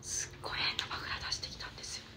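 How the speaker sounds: late-night whispered anecdote. Real, not acted. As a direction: tell it in a hush.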